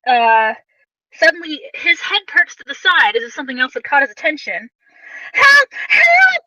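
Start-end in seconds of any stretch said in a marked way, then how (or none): 5.3-6.4 put-on high, loud desperate child's scream; child screaming for help. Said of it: "a child suddenly screams".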